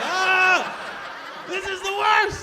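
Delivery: high, loud mock anguish; despairing wail. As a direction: cry out in comic despair.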